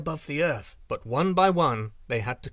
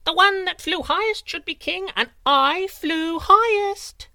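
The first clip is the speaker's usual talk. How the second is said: wren crowing; 8 dB louder than usual. A narrator's reading. Wren taunting the eagle from above, high and triumphant. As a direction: crow it from the sky. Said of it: "cried the wren".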